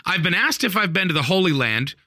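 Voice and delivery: high-pitched incredulous recap; bewildered exclamation. Retold or imitated. Teller reacts to Milton's wild claims, high baffled rant. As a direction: pile it up in exasperated disbelief. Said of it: "And I'm like".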